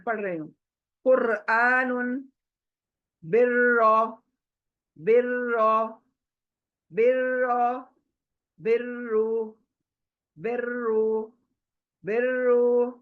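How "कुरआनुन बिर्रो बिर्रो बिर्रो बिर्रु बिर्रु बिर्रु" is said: बिर्रो with a doubled, full र; heavy ra. Shown as a demonstration. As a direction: repeat each word, र held thick. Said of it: "रॉ देखिए, मोटा पढ़". (बिर्रो में र मोटा और दोहरा)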